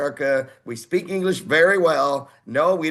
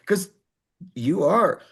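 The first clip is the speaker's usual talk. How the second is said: ordinary talk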